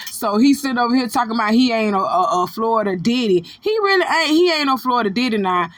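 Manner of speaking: ordinary talk, nothing marked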